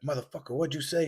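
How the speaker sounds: mock outrage, high but held down in volume; enraged driver. Driver confronting the other motorist, mock enraged. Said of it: "you're enraged".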